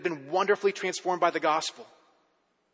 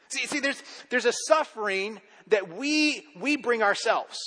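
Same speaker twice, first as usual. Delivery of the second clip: louder, driving sermon push, punching each no; fervent preaching; 3 semitones higher, 4 dB louder than usual. Acted. Preacher calling out the congregation's excuses, fervent and loud.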